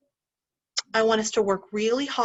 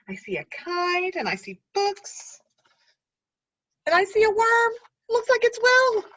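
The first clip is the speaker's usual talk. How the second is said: put-on pointing out the picture to the kids with joy; excited discovery; 9 semitones higher than usual. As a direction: build to a happy reveal.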